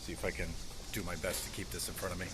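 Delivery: ordinary delivery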